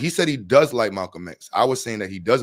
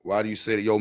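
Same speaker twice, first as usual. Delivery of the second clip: normal talking voice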